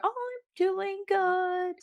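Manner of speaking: high, drawn-out chirpy voice; kind, chipper cashier. Retold or imitated. Podcaster imitating the kind cashier, high chipper voice. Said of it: "kind, chipper".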